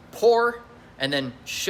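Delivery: "por" and "sh" slowly split apart, each sound held. Shown as a demonstration. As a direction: spell the sounds out one by one, slowly. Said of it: "it's two syllables".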